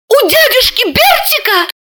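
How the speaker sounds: high squealed exclamation. A narrator's reading. Calm Katerina suddenly squealing the answer, high. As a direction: squeal out the name. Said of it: "Катерина взвизгнула".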